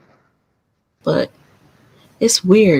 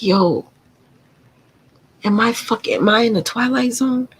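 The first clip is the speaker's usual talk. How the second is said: incredulous; 6 semitones higher than usual. Retold or imitated. Retold disbelief.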